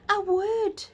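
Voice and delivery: thrilled to discover the wood; delighted. Acted. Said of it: "Beth said in delight".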